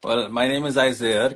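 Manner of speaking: ordinary talk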